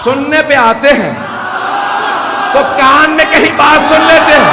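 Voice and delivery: loud, high mocking cry; sarcastic shout. Real, not acted. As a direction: ridicule them at full voice. Sarcastic preaching climax. (طنزیہ بلند آواز میں پکار)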